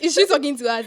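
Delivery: laughing in disbelief. Real, not acted. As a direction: crack up mid-question, loudly. Laughs while retelling disbelieving reaction.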